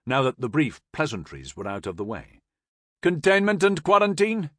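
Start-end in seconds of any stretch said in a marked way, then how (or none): 3.0-4.5 high, loud query; calling out. A narrator's reading. Major querying the corporal's instructions, calling across. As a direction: call the question over to the boat.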